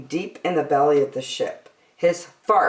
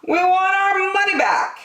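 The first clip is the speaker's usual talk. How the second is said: high, loud complaining passengers; angry passengers; 4 dB louder than usual. A narrator's reading. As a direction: demand it in a high whine.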